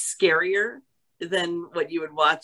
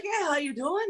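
higher, softly imitated Irish accent; Irish lads; 8 semitones higher, 4 dB quieter than usual. Soccer players greeting women at the bar, imitated Irish accent. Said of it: "it's the Irish national soccer team".

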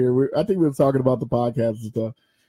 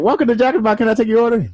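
higher, louder cheery drive-thru greeting; drive-thru worker; 7 semitones higher, 6 dB louder, much faster than usual. Retold or imitated. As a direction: chirp it like a fast-food worker on the speaker. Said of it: "They'll be like".

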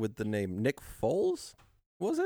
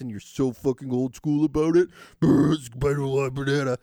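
loud garbled voice through a mask; muffled wah wah talk; 9 dB louder than usual. Retold or imitated. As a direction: mumble it like through fabric. Mimics talking through lace mask.

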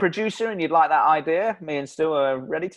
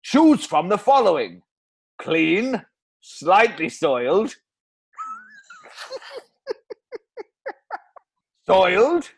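slow, listing Welsh ad voice; Welsh accent; 4 semitones higher than usual. Acted. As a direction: lay out each option in Welsh tones. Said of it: "Bloody Welsh accent again".

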